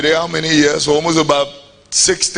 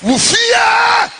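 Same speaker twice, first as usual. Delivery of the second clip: much higher, slow, hammed-up preacher cry; shouting preacher; 3 dB louder, much slower than usual. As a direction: belt it out like a pulpit preacher.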